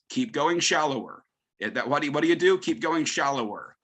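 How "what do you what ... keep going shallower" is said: ordinary delivery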